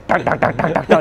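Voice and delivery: rapid tak-tak-tak ticking noise, laughing; noisy wheel clicking. Retold or imitated. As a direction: mimic a loud freewheel clicking, half laughing. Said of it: "it's the noisiest wheel ever".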